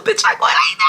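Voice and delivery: squealing through laughter; words break up into very high laughter; real laughing. Real, not acted. Cracking up at her own reaction.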